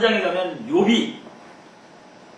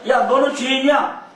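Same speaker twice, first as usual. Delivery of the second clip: accusing shout; 8 semitones higher, 5 dB louder than usual. Acted. Friends accusing Job.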